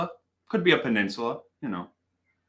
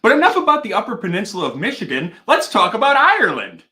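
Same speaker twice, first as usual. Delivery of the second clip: punchline reveal; 6 semitones higher, 8 dB louder than usual. Real, not acted.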